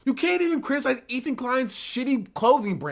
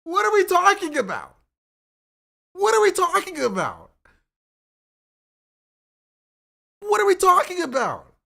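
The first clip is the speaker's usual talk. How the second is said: higher and louder, incredulous; exasperated; 10 semitones higher, 4 dB louder than usual. Real, not acted. Ranting at the viewers, loud incredulous exasperation. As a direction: throw up your hands in disbelief. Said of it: "exasperated repeated question".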